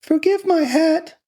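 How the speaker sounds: put-on very high, loud falsetto girl; childish tearful voice. A narrator's reading. Laura to the dead man after a sob, childish plea. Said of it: "gave a loud childish sob".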